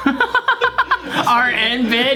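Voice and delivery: mock demon voice, laughing; contemporary demon. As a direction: echo the bratty demon line. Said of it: "That's not even a scary demon anymore".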